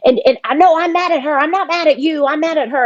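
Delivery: imitating an upset brother's outburst; retold angry outburst. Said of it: "he got really loud, and he's like".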